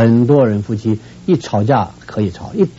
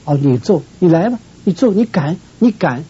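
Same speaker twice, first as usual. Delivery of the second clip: defiant dare; 7 semitones higher than usual. Retold or imitated. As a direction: goad him to go ahead and hit. Wife daring husband to hit.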